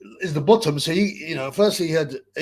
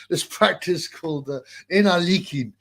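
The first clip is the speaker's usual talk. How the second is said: ordinary delivery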